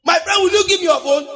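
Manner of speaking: impatient robber. Acted. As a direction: press the victim to hand it over. Robber presses the victim for phone.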